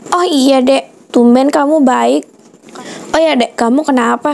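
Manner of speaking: ordinary delivery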